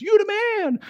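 high, hammed-up cheer; mock cheer. Audience member hailing Jesus, high mock cheer. Mimics an excited crowd cheer.

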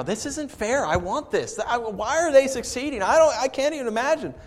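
high, whining protest; whiny complaint. Retold or imitated. Pastor voicing envious believers, whiny. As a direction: complain like a cheated child. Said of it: "we as believers stand there and go".

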